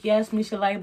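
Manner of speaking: ordinary delivery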